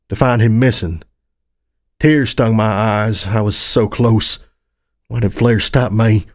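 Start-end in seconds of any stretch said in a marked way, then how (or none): none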